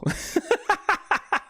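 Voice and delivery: high staccato laugh. Real, not acted. Streamer laughing off the very idea, high laughter. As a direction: burst into a high, rapid cackle of laughter. Laughs after firm refusal.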